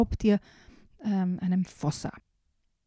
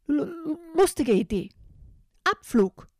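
commanding bird; 8 semitones higher than usual. Acted. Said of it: "kommandierte Bellissima".

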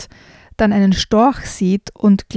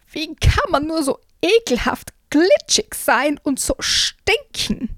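high, slow, disgusted shriek; 7 semitones higher, slower than usual. Acted. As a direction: shriek in disgust like a giant princess. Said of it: "eine kreischende Stimme".

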